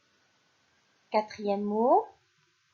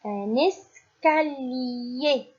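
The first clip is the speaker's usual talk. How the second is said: "un escalier" very slow, syllable by syllable; slow dictation; 2 semitones higher, much slower than usual. Shown as a demonstration. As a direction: draw out "un escalier" for young pupils. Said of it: "Deuxième mot".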